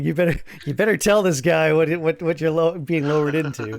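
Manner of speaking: hammed-up telling-off; mock urgent warning. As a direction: raise your voice in playful insistence. Acts out a warning to companions.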